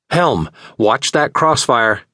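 slow, high urgent call; urgent warning. A narrator's reading. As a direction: warn the helm sharply. Captain warns helm in battle.

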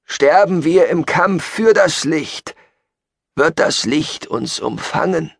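high, slow rapturous preacher; Gabriel. Acted. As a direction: promise salvation to the faithful. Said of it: "sagte Gabriel". (langsam, hoch und feierlich predigend)

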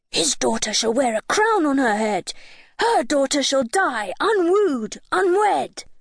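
high, swooping dog rhyme; barking little dog. A narrator's reading. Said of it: "and barked, saying".

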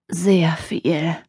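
hoarse, whispered croak. A narrator's reading. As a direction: croak it out, barely voiced. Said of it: "krächzte er". (heiser gekrächzt)

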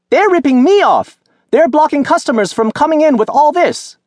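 put-on very high, loud outraged old woman; angry vendor shout. A narrator's reading. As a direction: shriek out the complaint. Fruit seller shouts angrily.

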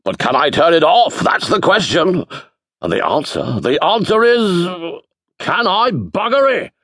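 put-on theatrical booming old wizard. A narrator's reading. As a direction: boom it out like a grand wizard. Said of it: "boomed the wizard".

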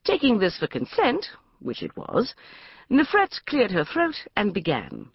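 usual speaking voice

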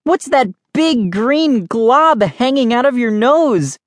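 high, drawn-out mock disgust; shouted. A narrator's reading. Kid distracting sister from serve, shouting slowly. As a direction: slowly exclaim in exaggerated disgust. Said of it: "I shouted".

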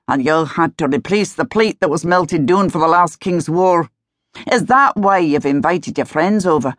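gently chiding Scots husband; scottish laird. Acted. Duke voiced in Scots.

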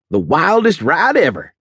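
high, eager Texas drawl; a young cowboy's southern drawl. A narrator's reading. Eager student asking the instructor in a southern drawl. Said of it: "and a drawl to match".

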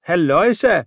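a cheerful loud halloo; calling out. A narrator's reading. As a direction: shout it out cheerfully, pleased with a find. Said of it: "råbte han".

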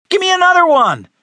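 high, loud squeal of delight; delighted shout. Acted. Frederick wanting another water bomb, squealing excited shout. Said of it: "shouted Frederick, squealing with delight".

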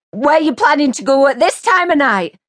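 high, loud and accusing; sharp demanding question. A narrator's reading. Mary confronting Jay in the hall, accusing. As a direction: demand an answer, shrill. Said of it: "she asked".

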